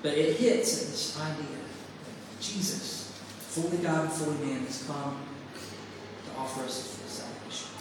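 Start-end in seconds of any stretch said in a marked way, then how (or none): none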